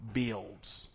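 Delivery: ordinary delivery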